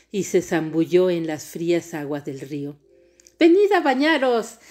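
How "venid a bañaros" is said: high, loud call; exclaiming. A narrator's reading. Princess calling the girls into the river, exclaiming. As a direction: call out to friends to join in. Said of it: "exclamó To".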